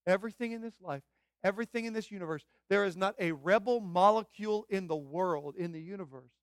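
rising into a loud proclamation; emphatic preaching. Real, not acted. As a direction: drive it home to the congregation. Preaches God's total sovereignty.

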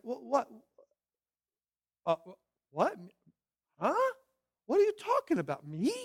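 squeaky high feigned shock, fast; mock innocent surprise. Preacher voicing a sinner caught lying, feigning shock. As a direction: play dumb, shocked at the accusation.

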